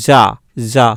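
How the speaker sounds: "যা" said as za, buzzing; half j, as z. Shown as a demonstration. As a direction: isolate "যা" with z, not j. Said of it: "সম্পূর্ণভাবে কিন্তু বর্গীয় জ উচ্চারণ হচ্ছে না".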